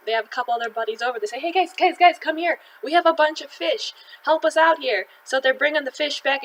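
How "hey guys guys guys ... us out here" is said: loud, high call to friends across the water. Retold or imitated. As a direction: hail the other boat, urgent. Fishermen call buddies for help.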